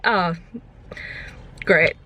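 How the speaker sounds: ordinary delivery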